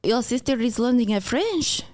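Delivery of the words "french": higher-pitched put-on French accent; mock French accent. Mocking French accent on sister's lessons.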